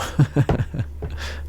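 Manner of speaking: quiet, mostly voiceless laugh; soft chuckle. Real, not acted. Reviewer totalling costs before a camera, soft chuckle. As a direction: laugh softly to yourself.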